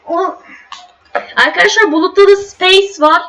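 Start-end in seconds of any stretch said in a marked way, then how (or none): none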